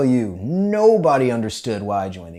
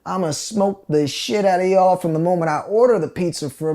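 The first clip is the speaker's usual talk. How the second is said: higher mock sergeant threat; drill sergeant threat; 6 semitones higher, 4 dB louder than usual. Sergeant threatening the platoon, gleeful menace. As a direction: promise punishment with relish. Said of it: "He's like".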